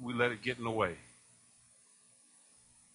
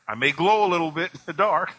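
louder, laughing through the joke; 2 semitones higher, 8 dB louder than usual. Real, not acted. Preacher joking to congregation about glowing, laughing. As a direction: chuckle the words out.

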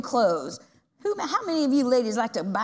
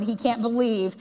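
ordinary talk

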